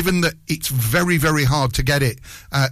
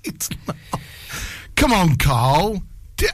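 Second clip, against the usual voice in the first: ordinary delivery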